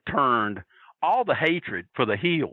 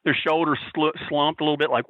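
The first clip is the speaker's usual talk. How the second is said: normal talking voice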